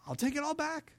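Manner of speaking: a lightly voiced, mock-hurt God; God's hurt, sarcastic reply. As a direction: lightly voice God, feigning offense. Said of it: "And God looks down and goes".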